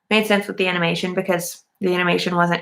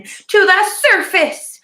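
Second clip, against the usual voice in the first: higher, louder, slow triumphant announcement; dramatic climax; 11 semitones higher, 6 dB louder, much slower than usual. Retold or imitated. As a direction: draw it out like an epic climax. Climax of retold plot.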